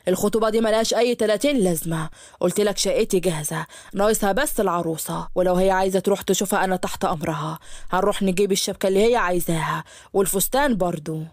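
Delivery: put-on low, impatient young man pleading; a groom brushing off the engagement; pushy suitor. Yusuf argues against engagement.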